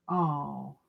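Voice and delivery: ordinary talk, nothing marked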